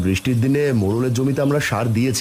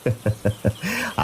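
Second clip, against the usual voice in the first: soft indulgent chuckle; reassuring chuckle at a child's worry; fatherly laugh; 5 dB quieter than usual. Acted. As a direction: laugh it off quietly. Father laughs at daughter's confession.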